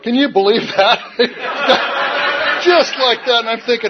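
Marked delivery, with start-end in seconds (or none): 1.0-2.6 airy high-pitched laugh; a quick disbelieving laugh; high breathy laugh. Real, not acted. Laughs at the memory.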